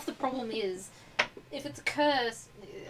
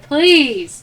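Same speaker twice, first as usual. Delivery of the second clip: desperate loud plea; 3 semitones higher, 13 dB louder than usual. Acted. Desperate prayer to a god.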